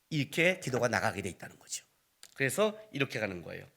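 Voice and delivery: normal talking voice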